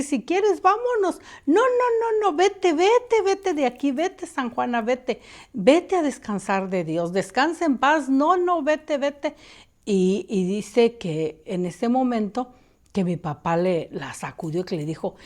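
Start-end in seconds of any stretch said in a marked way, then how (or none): 0.0-9.4 much higher, louder frantic shooing; terrified refusal. Retold or imitated. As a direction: beg the ghost to leave. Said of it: "se espantó muchísimo". (rechazo aterrado, mucho más agudo)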